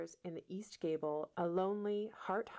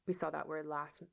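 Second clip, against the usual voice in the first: plain reading voice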